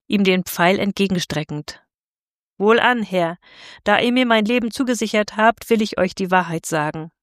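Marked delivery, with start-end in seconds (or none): none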